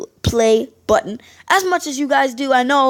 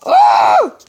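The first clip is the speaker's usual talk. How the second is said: short yell; 12 semitones higher, 7 dB louder than usual. Real, not acted. Yelling at police.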